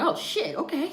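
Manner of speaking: high, loud startled oh shit; panicked gasp. Retold or imitated. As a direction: blurt it out in sudden panic. Panic when told to leave fast.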